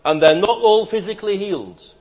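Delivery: emphatic pulpit line; preaching surge. Acted. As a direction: ring it out.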